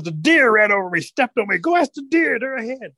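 high-pitched storybook bear; excited animal character. Acted. Bear tells Rabbit the deer ran over him, excited.

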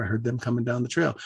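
ordinary delivery, nothing marked

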